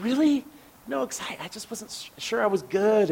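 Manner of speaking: high, faint and unsure, fading away; shy singer brushing off compliments; timid disbelief. Retold or imitated. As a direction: shrink from the praise, barely audible. Singer doubting own talent.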